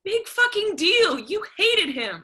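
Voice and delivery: bitter outburst. Acted. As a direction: hurl it at her mother in contempt. Daughter lashes out at mother.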